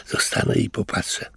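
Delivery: whispered to himself; curious whisper. A narrator's reading. Louis decides to watch, whispering. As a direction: breathe it softly, curious. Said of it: "pomyślał zaciekawiony Louis".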